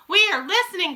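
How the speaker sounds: high, loud eager crowd; crying out. A narrator's reading. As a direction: call out as an eager audience. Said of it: "cried the audience".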